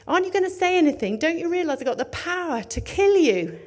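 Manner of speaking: loud, high and fast demanding shout; hammed-up angry governor challenging a prisoner; Pilate's threat. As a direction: rush it out, loud and high, like a furious official.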